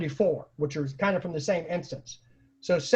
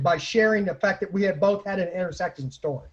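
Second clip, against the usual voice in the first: usual speaking voice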